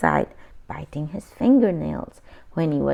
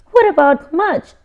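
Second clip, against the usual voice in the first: high, louder anxious plea; worried question; 9 semitones higher, 7 dB louder than usual. A narrator's reading. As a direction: ask in alarm for the dog. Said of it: "asked Henry".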